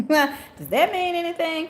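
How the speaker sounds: asked slowly through a high laugh; laughing question. Real, not acted. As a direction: laugh while asking, amused. Laughs at mosquito card.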